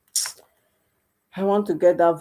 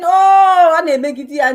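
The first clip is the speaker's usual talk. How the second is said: mock outcry; 9 semitones higher, 12 dB louder than usual. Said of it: "We are all saying".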